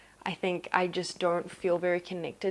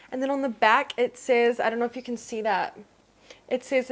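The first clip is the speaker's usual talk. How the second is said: usual speaking voice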